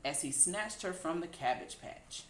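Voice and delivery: ordinary delivery, nothing marked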